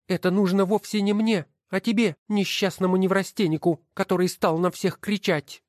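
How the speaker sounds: high, scornful woman's voice; wife's voice. Acted. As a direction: sneer at each title as you sort them. Wife jabs at her husband. (высокий насмешливый голос жены)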